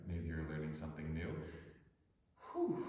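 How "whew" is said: short high exhale; breathless whew. Real, not acted. Teacher catching breath after headstand, whew. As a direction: puff out the breath. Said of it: "I'm out of breath".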